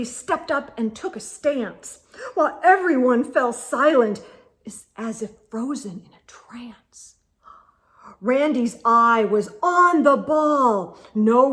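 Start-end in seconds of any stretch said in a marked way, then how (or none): 4.6-7.2 frozen hush; whisper. Acted. Reader describing the silent crowd, whispered. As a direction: let it fall to a whisper. Hushed scene.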